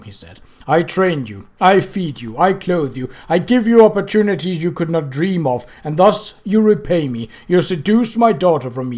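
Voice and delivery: loud, relentless list of wrongs; Death's cold rebuke. Acted. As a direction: scold him with icy weight.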